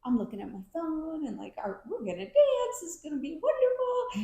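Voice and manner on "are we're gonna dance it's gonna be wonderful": gleeful, high-pitched singsong excitement; excited anticipation. Retold or imitated. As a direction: act out giddy delight, much higher and a bit louder. Imitates own happy anticipation before line dancing.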